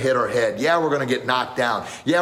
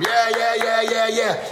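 cheering; 11 semitones higher, slower than usual. Retold or imitated. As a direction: cheer him on. Everybody cheers him on.

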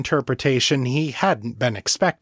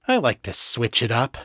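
plain reading voice

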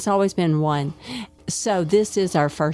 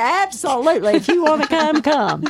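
excited welcome; 9 semitones higher than usual. Retold or imitated. Retelling her answer to men.

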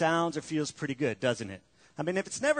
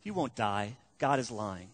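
lower, softer sly serpent voice; 7 semitones lower, 4 dB quieter than usual. Retold or imitated. Serpent lying to Adam and Eve, low and sly. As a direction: let the snake slide the lie in low. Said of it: "to trust the serpent and says".

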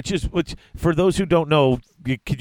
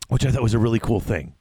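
ordinary talk

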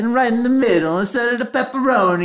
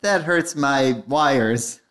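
low, slow droid voice; a low, slowed-down droid complaining; robot droid voice; 8 semitones lower, 3 dB quieter, slower than usual. Acted. Droid R3D4 complaining in a low, slow voice. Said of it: "that is R three D four".

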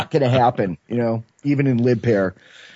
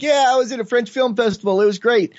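high-pitched, wired festival-goer gushing; high mock-excited boast; giddy bragging; 10 semitones higher than usual. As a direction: gush it high, sleepless and giddy. Acts out his all-night wired self.

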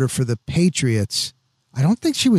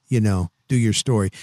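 ordinary talk

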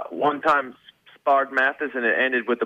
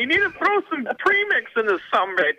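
much higher goofy voice; imitating Scott. A friend chiming in as Scott, high and goofy. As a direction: play the bossy rider high and silly.

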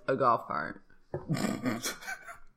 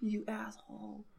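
hushed whispered insult; whispering; 4 semitones higher, 11 dB quieter than usual. Pretend. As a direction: breathe the insult under your breath. Mock scolding friend.

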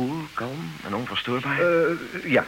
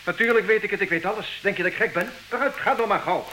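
irritable snapping; 7 semitones higher than usual. Acted. Man snaps at impatient wife.

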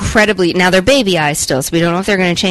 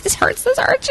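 much higher, small mock-pained voice; whiny pained squeak; 5 dB quieter than usual. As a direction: squeak it out like a hurting child. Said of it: "and I'm like".

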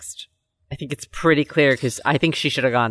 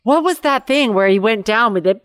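ordinary delivery

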